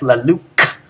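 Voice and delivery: "la luc" cut off on a hard, popped k; clicked kappa. Shown as a demonstration. Teacher showing students the Greek kappa, clicked k. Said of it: "you click your lips".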